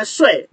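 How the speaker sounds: "sui" with a high, sharp falling tone; nada keempat. Shown as a demonstration. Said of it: "nah itu artinya apa?". ("sui" nada empat, tinggi lalu jatuh tajam)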